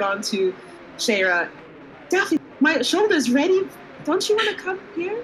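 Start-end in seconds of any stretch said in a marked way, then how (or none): 2.1-5.2 coaxing. Acted. Coaxing companion onto shoulder, in character.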